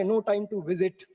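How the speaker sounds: ordinary talk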